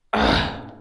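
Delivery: loud, low grunt of frustration; stumped, exasperated groan; frustrated groan. Acted. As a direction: let out a low, loud "ugh".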